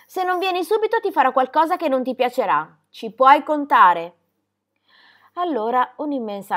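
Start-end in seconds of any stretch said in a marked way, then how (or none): 0.0-4.2 raised, angry warning; fiery threatening shout. A narrator's reading. Girl threatening the stubborn goatherd, angry shout. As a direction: threaten loudly, slowing hard on the final words. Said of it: "con occhi fiammeggianti gridò".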